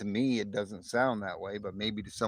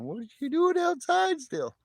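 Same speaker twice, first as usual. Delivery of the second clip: very high, louder pet voice; Mala Bear; 6 dB louder than usual. Pretend. Owner voicing the dog at the door, silly high voice. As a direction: squeal it as the dog asking. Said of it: "Mala Bear saying".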